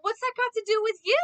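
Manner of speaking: mock-clueless Goku asking. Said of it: "And he's like".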